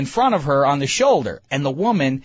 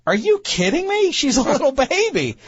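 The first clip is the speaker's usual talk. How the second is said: much higher, half-laughing disbelief; are you kidding me, pitched up high; incredulous wife; 11 semitones higher than usual. Retold or imitated. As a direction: imitate the wife's stunned protest. Wife's indignant retort, quoted.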